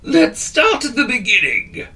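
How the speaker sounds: put-on a fussy old wizard correcting; wheezy wizard. A narrator's reading.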